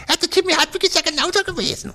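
sneering imitation of a gossip; mocking gossip. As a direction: mimic the wagging tongues. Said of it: "böse Zungen".

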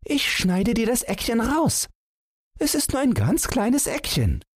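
put-on very high small boy's voice; self-persuading child. A narrator's reading. Son offers father a corner, high self-persuading child's voice. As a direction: offer a concession as if convincing yourself, childlike. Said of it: "wie sich selbst überredend hinzu".

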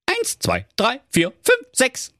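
immer höhere Kinderstimmen; Geißlein zählen. Acted. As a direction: der Reihe nach abzählen. (steigend hohes Durchzählen)

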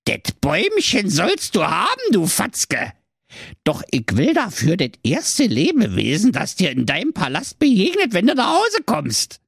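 high, mocking dwarf voice; a scheming little villain naming his price; sly evil dwarf.

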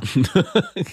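kurzes hohes Auflachen; hohes Lachen. Real, not acted. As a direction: hell und kurz auflachen. Lacht über Sahara-Vergleich. (hohes Auflachen)

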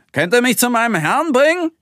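high, loud tomcat character voice; cat calling out. Acted. Tomcat hailing the rocket crew for a ride, high loud call. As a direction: call them over as a storybook cat. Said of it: "Der Kater ruft sie herbei".

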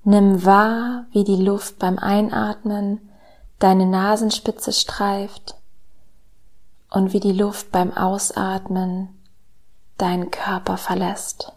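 slow, soft meditation voice with whispered lines; calm guided meditation, hushed and slow; soft spa whisper. Acted.

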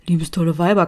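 plain reading voice

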